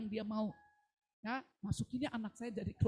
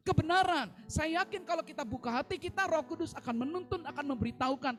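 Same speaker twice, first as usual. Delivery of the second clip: louder, higher call to the congregation; rousing exhortation; 5 semitones higher, 6 dB louder than usual. Real, not acted. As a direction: urge them on with conviction.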